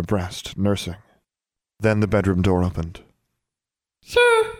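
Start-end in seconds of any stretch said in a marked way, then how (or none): 4.0-4.5 very high squeaky little elf. A narrator's reading. House-elf stopping the master at the door, very high squeak. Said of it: "came the high, squeaky voice of an elf".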